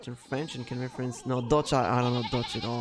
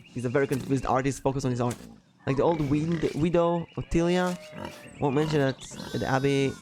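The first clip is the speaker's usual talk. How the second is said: ordinary talk, nothing marked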